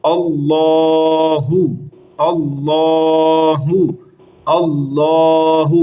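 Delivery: اللہ with a thick lam drawn out very slowly; اللہ, lam heavy and long. Shown as a demonstration. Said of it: "لام کو موٹا پڑھیں گے اور لمبا کریں گے".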